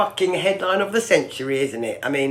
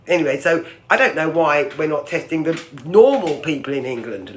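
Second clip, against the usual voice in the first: ordinary talk, nothing marked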